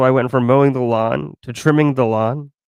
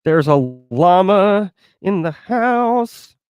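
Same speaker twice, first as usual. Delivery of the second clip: slow, higher, sing-song announcement; drawn-out announcer call; 5 semitones higher, 3 dB louder, much slower than usual. Acted. Repeating as an announcement.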